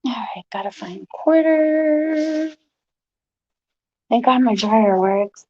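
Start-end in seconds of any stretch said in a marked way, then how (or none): 0.0-2.5 whisper. Real, not acted. Muttering to herself while searching.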